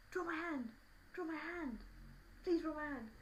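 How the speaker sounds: high, put-on begging boy; pleading schoolboy. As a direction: mimic a boy pestering for a favour. Acts boy begging for drawing.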